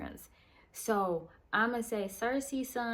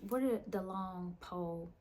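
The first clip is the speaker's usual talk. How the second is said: normal talking voice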